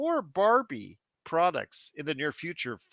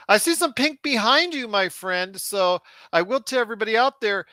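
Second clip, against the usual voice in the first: excited plug; 8 semitones higher, 4 dB louder than usual. Acted. Enthusiastic plug for sponsor.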